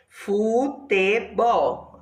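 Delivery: Futebol slowly split as fu, te, bol; very slow, drawn-out Futebol; syllables separated. Shown as a demonstration. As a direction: give each syllable of Futebol its own beat. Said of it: "três sílabas".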